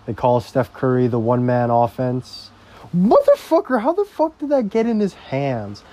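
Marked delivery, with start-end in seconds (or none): 2.9-5.8 sudden high angry yell at the screen; furious outburst. Real, not acted. Fan yelling at the TV as the shot goes in, outraged.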